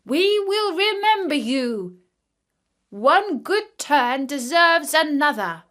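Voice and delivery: a lightly voiced ant king calling out his thanks; ant king crying out. A narrator's reading. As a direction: call up gratefully from the sand like a tiny king. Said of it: "the ant king cried out to him".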